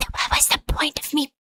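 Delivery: whispered, defeated exasperation; hushed, voiceless disbelief; exasperated near-whisper. Real, not acted. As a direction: drop to a despairing whisper. Exasperated at a pointless call.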